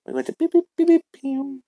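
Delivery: high-pitched beep beep pew sounds; beeping noises. Acted. Kid imitating a machine with high chirpy beeps.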